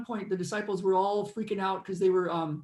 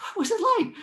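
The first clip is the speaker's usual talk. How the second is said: exasperated question; 13 semitones higher, 6 dB louder, faster than usual. Retold or imitated. Retelling own question to friend.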